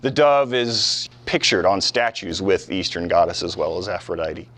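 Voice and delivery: ordinary talk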